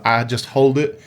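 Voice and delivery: ordinary talk, nothing marked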